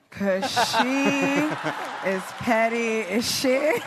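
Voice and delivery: laughing through "is petty as shit", slowed; cracking up at the pettiness. Real, not acted. Laughing while speaking.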